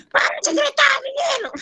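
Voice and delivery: shrill high mock shout at a child; yelling mother. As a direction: play the mother who screams at her boy. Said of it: "já viu mãe que começa a gritar".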